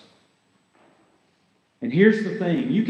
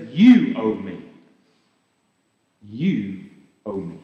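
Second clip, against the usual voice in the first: voice of anger; 6 semitones lower than usual. Retold or imitated. Pastor voicing anger to the congregation, mild mock anger fading. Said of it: "Anger says".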